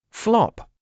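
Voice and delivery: high, bouncy flop; flop sound. A narrator's reading. Narrator voicing a pancake flop. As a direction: land the pancake with a pop.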